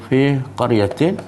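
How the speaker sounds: slow, drawn-out Quranic recitation. Acted. Teacher recites the verse to the class, slow and measured. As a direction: recite each word slowly and carefully.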